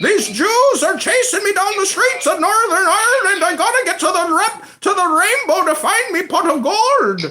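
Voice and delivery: high, loud hammed-up Irish accent; mock leprechaun voice. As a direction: squeal it like a panicky leprechaun. Mocking the Irish leprechaun caller.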